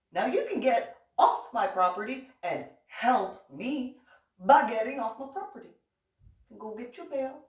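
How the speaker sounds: indignant scolding. Retold or imitated. Retold telling neighbor off.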